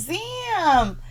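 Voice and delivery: high, drawn-out shocked damn; retelling her shocked reaction; stunned damn. Retold or imitated. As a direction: let the damn rise way up in disbelief. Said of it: "I was just like".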